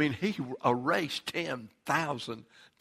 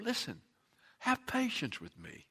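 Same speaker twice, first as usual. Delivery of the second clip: begging; 7 semitones higher, 9 dB quieter than usual. Acted. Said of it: "he begged him".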